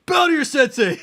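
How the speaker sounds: high, loud sensei bark, laughing; sharp martial arts commands. Retold or imitated. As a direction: yell it like Rex the sensei. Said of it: "He's all".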